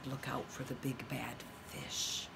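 soft, low whispered warning; whispering. Retold or imitated. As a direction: whisper it with suspense. Oh, she said.

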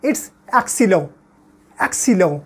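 excellent as French ek-say-LON, nasal end; French pronunciation. Shown as a demonstration. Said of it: "In French".

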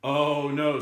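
acted parental alarm; dismayed father. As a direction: exclaim it like an exasperated dad. Father dismayed at junk food.

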